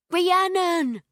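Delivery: high, loud joyful shout of a name; excited yell. A narrator's reading. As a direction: call out, running in with arms open. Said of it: "he yells".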